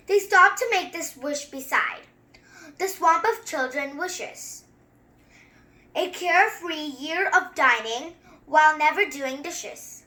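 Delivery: measured, rhythmic read-aloud; a kid reading a picture book to viewers; storybook reading. Acted. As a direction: read it like a bedtime rhyme. Reads the book text.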